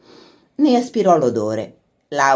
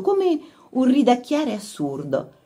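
ordinary delivery

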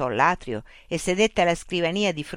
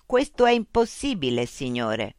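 machine voice. Acted. Loudspeaker robot voice addressing Mitch. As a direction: speak as a polite, mechanical information unit.

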